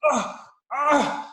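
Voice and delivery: loud, high-pitched grunts of effort; straining child's grunts. Retold or imitated. Kid wrestling with seatbelt, straining. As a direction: imitate a kid straining and failing. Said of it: "this is what he does".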